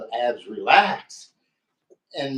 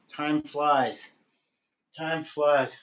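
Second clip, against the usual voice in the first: ordinary talk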